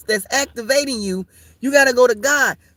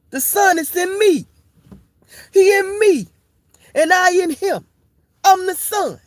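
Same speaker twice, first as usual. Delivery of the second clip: higher, preachy climax; rising preacher fervor; 8 semitones higher than usual. Acted. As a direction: build it up like a revival preacher. Mock preacher climbing.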